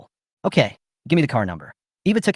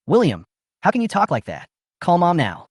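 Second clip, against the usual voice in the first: mild, higher-pitched scolding; angry scolding; 6 semitones higher than usual. A narrator's reading. Nina scolds William over his words, mildly angry. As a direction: scold him with a touch of anger. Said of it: "Nina angrily scolded me".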